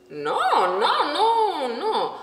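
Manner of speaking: laughing denial. Retold or imitated. The beach guy laughing off her question.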